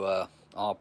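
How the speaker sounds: ordinary talk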